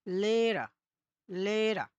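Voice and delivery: লেরা with a stretched e; লেরা, h silent, vowel long. Shown as a demonstration. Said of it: "এইচটা কি আছে? আমার উজ্জ্ব আছে".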